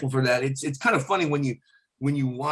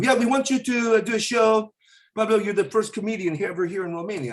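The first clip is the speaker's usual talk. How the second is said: put-on Romanian accent; 9 semitones higher than usual. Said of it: "the guys go".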